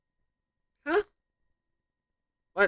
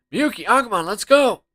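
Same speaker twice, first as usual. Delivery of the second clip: normal talking voice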